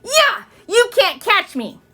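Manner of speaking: high, loud playground taunt; a boy teasing Ramona; taunting yell. A narrator's reading. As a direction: shout it running away.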